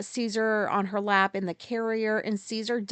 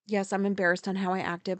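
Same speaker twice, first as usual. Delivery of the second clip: ordinary delivery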